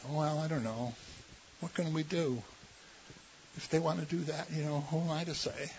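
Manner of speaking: hand-wringing, mock-helpless shrug; timid shrug. Preacher mocking the passive churchgoer's soft excuse.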